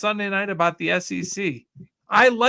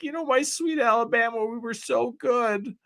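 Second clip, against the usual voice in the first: mock crying; 5 semitones higher than usual. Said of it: "and he was crying".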